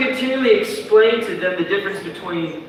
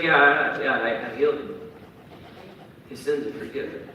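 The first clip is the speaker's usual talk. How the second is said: calm Jesus; 4 semitones lower, 6 dB quieter than usual. Retold or imitated.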